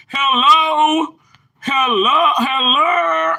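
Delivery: high, drawn-out catchphrase hello; imitating Johnny. Streamer copying how Johnny said hello, playful imitation. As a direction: sing out a playful mimicked hello. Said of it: "How Johnny said".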